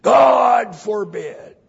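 slow, high, thundering exclamation; emphatic shout. Shown as a demonstration. Preacher voicing the King James rendering, emphatic shout. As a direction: cry it out with long vowels. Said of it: "That doesn't sound very emphatic".

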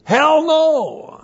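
shouted high and loud, hell no; mock colonel's loud shout; emphatic shout. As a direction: bark it out like a tough old colonel. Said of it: "the way the colonel said to translate this".

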